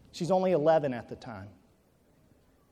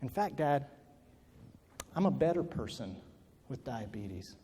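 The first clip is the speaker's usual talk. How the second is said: quiet; 5 semitones lower, 8 dB quieter than usual. Retold or imitated. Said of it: "what she was saying to me is".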